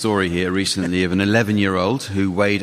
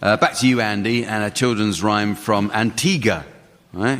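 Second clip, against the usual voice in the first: ordinary delivery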